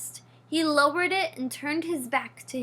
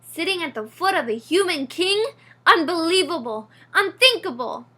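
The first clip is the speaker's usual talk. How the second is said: loud, higher-pitched outrage; outraged; 4 semitones higher, 7 dB louder than usual. A narrator's reading. Father mouse rants about son at king's feet, loud outraged rant. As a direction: rant each word in disbelief.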